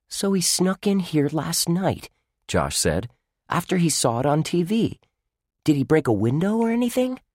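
put-on high, curious young boy. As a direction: ask it like a puzzled kid. Josh speculates about the burglar.

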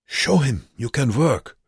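put-on high, accented exhortation; urging. A narrator's reading. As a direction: spur him on to prove himself. Wernicke urges Joseph.

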